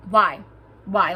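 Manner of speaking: loud repeated why, pressing hard; exasperated. Real, not acted. Vlogger venting to viewers, loud exasperated why. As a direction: hammer the question home.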